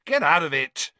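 loud, high angry yell; explosive annoyed shout. A narrator's reading. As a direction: shoo it off furiously. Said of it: "shoved Crookshanks angrily away".

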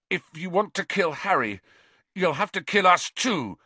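defiant and fierce, rising up. A narrator's reading. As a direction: stand firm against him, fiercely. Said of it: "he said fiercely".

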